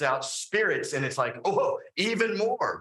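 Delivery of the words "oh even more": high mock astonishment; astonished crowd. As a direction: gasp it out as the amazed crowd.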